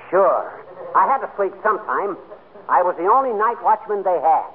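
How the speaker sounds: ordinary talk, nothing marked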